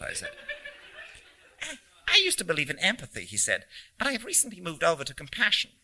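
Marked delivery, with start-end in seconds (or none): 1.6-3.2 higher, quick, lightly squeaky little man; a touch of squeak, rattled off. A narrator's reading. Stranger confiding his beliefs to a passenger, quick and lightly squeaky. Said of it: "he said in a squeaky voice". 4.0-5.7 higher, quick, lightly squeaky little man; a touch of squeak, rattled off. A narrator's reading. Stranger confiding his beliefs to a passenger, quick and lightly squeaky. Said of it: "he said in a squeaky voice".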